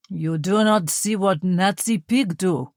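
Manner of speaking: put-on slow, thick Russian accent, spitting out pig; Russian officer telling the priest about Nazi crimes, heavy accent; strong Russian accent. A narrator's reading. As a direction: lean hard into the Russian accent, slowly. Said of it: "his accent very pronounced".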